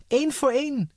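high, loud urgent shout; added shout. A narrator's reading. Girls warning the king about balloons, high shout. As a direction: yell the warning up fast. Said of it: "voegden ze eraan toe".

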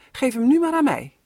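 put-on a boy begging for his turn; eager demand. Robin asks his father for the kite, eager and loud.

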